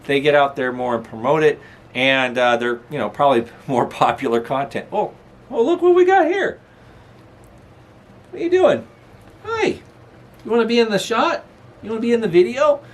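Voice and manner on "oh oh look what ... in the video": talking to the dog. Real, not acted. Greeting the dog, Abigail.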